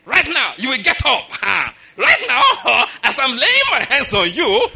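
loud, high preacher bellow, hammed-up; mock preacher shout. Mimicking a TV preacher healing someone, hammed-up shouting. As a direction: command it like a theatrical healing preacher. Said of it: "You have watched too many preachers".

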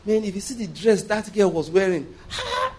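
breathless, laughing wow at a dress; excited admiring. Pretend. Preacher mimics a man ogling a dress, excited and laughing. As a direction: gush over the girl's dress, half laughing.

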